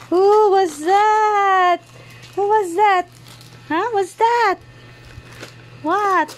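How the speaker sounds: put-on high-pitched baby-talk wonder; playful amazement. Feigned surprise at baby's gift.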